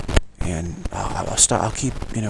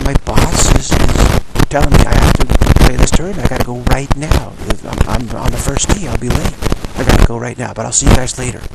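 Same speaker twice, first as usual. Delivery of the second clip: louder, insistent exit line; firm excuse to leave; 3 semitones higher, 9 dB louder than usual. Retold or imitated. Telling his drinking buddies he must leave now, loud and insistent. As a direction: push it out loud so nobody argues. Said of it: "You gotta say".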